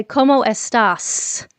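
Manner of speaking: slow gringo "¿Cómo estás?" with the S in "estás" stressed; drawn-out gringo "¿Cómo estás?"; mock gringo accent, every S pronounced; gringo Spanish. Shown as a demonstration. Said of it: "I don't say".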